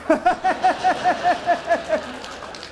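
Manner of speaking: long, high staccato cackle; drawn-out high mock laugh; character cackle. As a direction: cackle high in a steady run. Boss character's laugh ends catchphrase.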